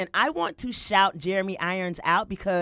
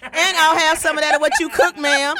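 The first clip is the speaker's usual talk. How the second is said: laughing; 9 semitones higher, 10 dB louder than usual. Pretend. Playing Ichabod answering flirt.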